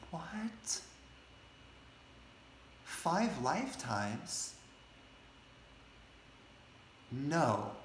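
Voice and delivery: high, drawn-out disbelief with long pauses; indignant disbelief. Acted. Priest hearing the sage's verdict, indignant disbelief. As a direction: act out stunned, offended refusal. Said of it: "he gets really angry at the sage".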